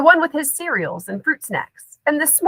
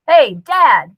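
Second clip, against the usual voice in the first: calling out; 3 semitones higher, 7 dB louder than usual. A narrator's reading. Scoob calls louder to father.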